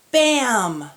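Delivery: a loud, high "Bam!"; loud bang. Acted. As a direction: burst it out like a door slamming. Oven door bursts open.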